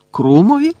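high, loud one-word question; surprised question. A narrator's reading. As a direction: blurt the name as a startled question. Ron reacts to the name Viktor. (здивоване перепитування, високо й голосно)

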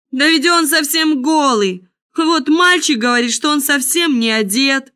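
high-pitched public exclamation; crowd's cry. A narrator's reading. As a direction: call it out as the whole crowd catching on. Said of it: "закричал, наконец, весь народ".

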